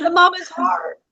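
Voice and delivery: high, tearful squeak of a voice; crying. Real, not acted. Moved by a mother's heart, crying for real. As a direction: squeeze the words out through tears. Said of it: "I'm gonna get all emotional again".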